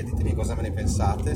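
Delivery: ordinary talk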